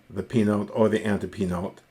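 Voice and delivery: usual speaking voice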